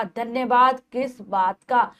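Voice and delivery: ordinary talk, nothing marked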